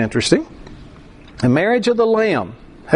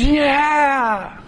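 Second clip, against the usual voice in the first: long, higher-pitched "yeah"; movie audience cheer; 6 semitones higher than usual. Acted. Movie audience seeing the bad guys killed, drawn-out cheer. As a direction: imitate a crowd cheering the ending. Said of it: "the audience goes".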